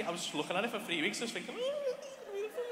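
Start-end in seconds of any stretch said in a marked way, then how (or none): 1.6-2.6 tiny, very high soft squeal; hushed falsetto swooning; giddy cooing. Retold or imitated. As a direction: coo it in falsetto, besotted. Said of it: "I was just giddy".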